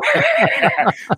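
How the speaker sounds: a high, far squeal of laughter. Real, not acted. Laughing at his own Masters joke, high squeal. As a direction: burst into a high giggle.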